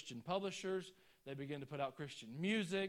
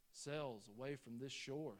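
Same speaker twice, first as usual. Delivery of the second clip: ordinary delivery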